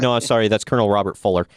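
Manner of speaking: normal talking voice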